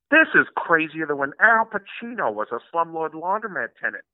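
cartoon dad setting up a gag; Peter Griffin. Comic re-creating the recorded cutaway line, cartoon impression. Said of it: "one line as Peter Griffin".

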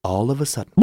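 usual speaking voice

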